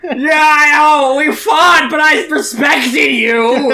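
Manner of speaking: high, loud sobbing speech; blubbering eulogy. Acted. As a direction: weep through a mock eulogy. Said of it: "He's just like".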